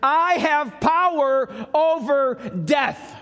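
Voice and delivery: slow, high, loud declaration; bold proclamation. Acted. Preacher voicing Jesus' power over death, shouted.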